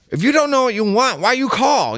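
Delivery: high, loud, fast impatient snap; impatient restaurant lady. Retold or imitated. Restaurant worker scolding a confused caller, high impatient snap. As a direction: scold the caller for wasting her time. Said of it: "she will be like".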